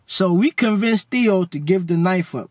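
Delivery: ordinary delivery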